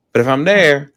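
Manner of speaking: ordinary talk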